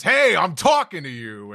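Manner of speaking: loud, high, drawn-out shout; Loki yelling. Acted. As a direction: yell to finally be heard. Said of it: "And then he finally yells".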